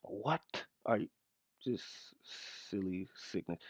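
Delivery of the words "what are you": normal talking voice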